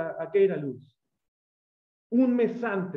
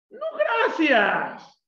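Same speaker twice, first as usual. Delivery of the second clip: laughing refusal; 8 semitones higher, 4 dB louder, much slower than usual. Acted. Mock refusal of God's call.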